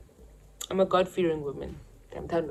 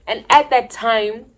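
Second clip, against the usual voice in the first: normal talking voice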